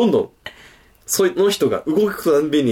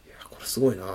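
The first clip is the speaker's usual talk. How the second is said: much softer, impressed in a hush; small voice; 9 dB quieter, faster than usual. Retold or imitated. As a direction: speak small so upstairs won't hear. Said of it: "ちっちゃい声で喋んなきゃいけない".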